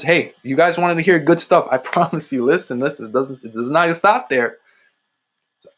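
ordinary delivery

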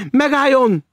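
high, loud cry of command; shout. A narrator's reading. Maud to the stranger heading for the door, shouting. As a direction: call out sharply to stop him. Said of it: "kiáltotta Maud".

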